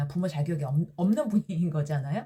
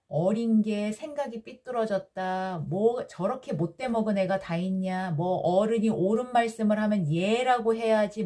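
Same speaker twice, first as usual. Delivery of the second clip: ordinary delivery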